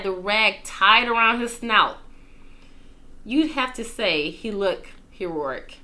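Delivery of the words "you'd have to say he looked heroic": plain reading voice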